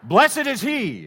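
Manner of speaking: high loud Hosanna to the congregation; bright loud call-and-response lead; leader's call. Shown as a demonstration. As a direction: call it out for them to echo. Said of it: "I'll say it, you repeat it".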